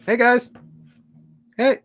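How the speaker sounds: high, tentative mock greeting; polite caller. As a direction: imitate a polite visitor at the door. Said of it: "knocks politely".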